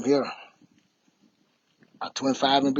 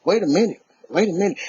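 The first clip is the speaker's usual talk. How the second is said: startled double take; 5 semitones higher, 6 dB louder than usual. Retold or imitated. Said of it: "and I was like".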